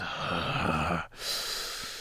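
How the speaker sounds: long soft tired sigh; a worn-out host sighs at the guest; weary sigh. Acted. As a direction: let out a heavy sigh. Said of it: "he kind of sighs".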